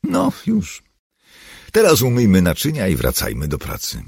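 firm instruction in a lifted voice; giving orders. A narrator's reading. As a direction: briskly send everyone back to work. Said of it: "polecił".

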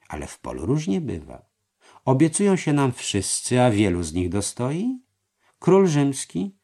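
voiced treasurer. A narrator's reading. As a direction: coax him into agreeing. Merheim argues. (podskarbi przekonuje mistrza)

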